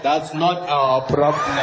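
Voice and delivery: low, slow gloating with a long chuckle; mimicking Zuma's dismissal. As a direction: throw his lazy laugh back at him. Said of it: "And we then like".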